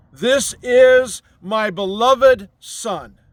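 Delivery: a swelling heavenly pronouncement, peaking loud and high on "you are"; voice of God. Retold or imitated. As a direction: proclaim it like God from the clouds. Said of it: "as God said to Jesus".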